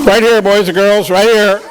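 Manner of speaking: calling out. Real, not acted. Calling kids over.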